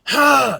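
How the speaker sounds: short, loud, high raspy "hah"; diaphragm scream burst. Shown as a demonstration. Coach showing diaphragm support, short screamed exhale. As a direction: punch the breath out from the belly. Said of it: "flexing your abs while you're doing it".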